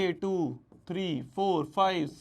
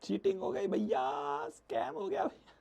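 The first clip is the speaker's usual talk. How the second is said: mock student whine; 13 semitones higher, 6 dB quieter than usual. Mocking students' complaint.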